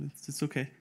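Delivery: usual speaking voice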